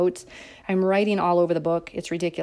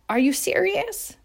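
mock disbelief; 10 semitones higher than usual.

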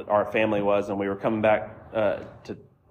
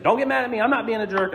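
mock defensive, much higher; defensive officer; 10 semitones higher than usual. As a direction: imitate a cop getting defensive. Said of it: "Immediately defensive".

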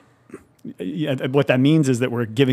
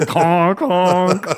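silly clown horn; 7 semitones higher, 5 dB louder than usual. Pretend. Mocking cheap audio gimmicks.

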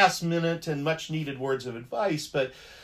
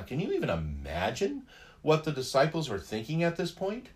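ordinary talk

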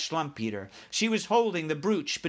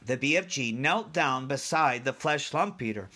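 ordinary delivery, nothing marked

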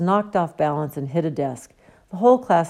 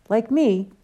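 surprised; 6 semitones higher than usual. Acted. Harry taken aback.

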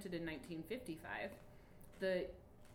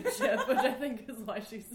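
loud, breaking up with laughter; laughing; 6 semitones higher, 12 dB louder than usual. Real, not acted. Host caught guest eating a chip, laughs through explaining. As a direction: try to explain while cracking up, louder and higher.

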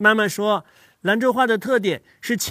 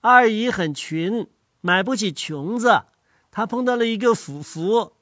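put-on Lanzhou accent. Child recites a Lanzhou-accent sentence for family. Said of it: "那你说说看".

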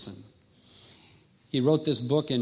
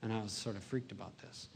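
ordinary talk

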